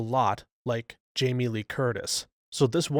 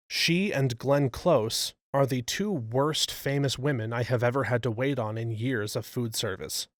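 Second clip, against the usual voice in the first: usual speaking voice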